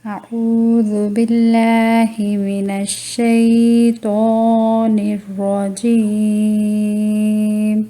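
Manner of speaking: slow, drawn-out melodic recitation; Quranic recitation. Acted. Teacher opens the lesson with a slow, chanted invocation. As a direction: intone the opening invocation slowly.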